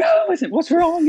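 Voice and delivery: panicked high squeak; freaking out. Retold or imitated. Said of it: "You know, I'm freaking out".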